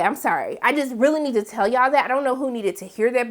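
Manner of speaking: ordinary delivery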